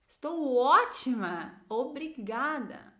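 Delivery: "ótima" loud and drawn out; full intonation. Shown as a demonstration. Teacher shows intonation, stretching "ótima" loud and slow. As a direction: stretch "ótima" and "obrigada" with lively emphasis. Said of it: "Lembrem de usar a entonação".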